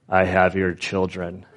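low, measured robot-kidnapper threat; lightly voiced scary megaphone computer; imitated scary voice. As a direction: do a mock-menacing computer voice, kept low. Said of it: "like this scary voice".

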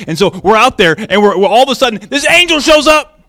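rising excited rush; excited storytelling. Retold or imitated. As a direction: build the thrill of the angel appearing. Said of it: "they're so incredibly excited".

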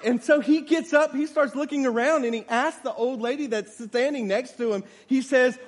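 loud, high, building sermon storytelling; full-force preaching. A narrator's reading. Preacher telling a congregation of a lame man lifted up, full-force preaching. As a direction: drive the story upward, loud and emphatic.

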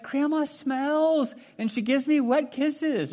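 put-on high, slow childish whine; whiny kid. Pastor playing a kid who doesn't want to hug grandma, whiny. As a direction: drag it out like a reluctant kid.

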